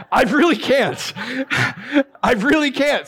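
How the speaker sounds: high, laughing through the words. Real, not acted. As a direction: admit it while still laughing. Laughing at his own confession.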